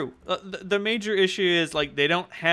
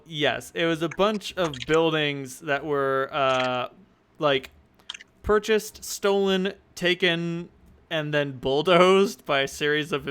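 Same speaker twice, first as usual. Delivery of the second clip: usual speaking voice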